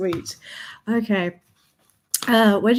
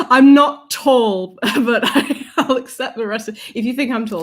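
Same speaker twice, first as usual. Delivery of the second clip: ordinary talk, nothing marked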